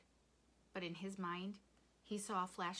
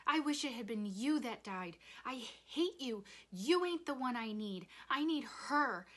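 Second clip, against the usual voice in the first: high, loud outburst of hurt; angry scream; 5 semitones higher, 7 dB louder than usual. A narrator's reading. Rob lashing out at his father, screaming. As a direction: build higher with each accusation. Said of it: "he screamed".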